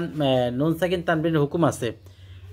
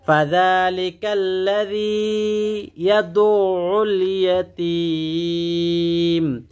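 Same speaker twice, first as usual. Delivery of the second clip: Quran recitation; 3 semitones higher, much slower than usual. Shown as a demonstration. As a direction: recite the whole verse melodically. Recites the verse. (উঁচু সুরে ধীর তিলাওয়াত)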